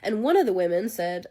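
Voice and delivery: normal talking voice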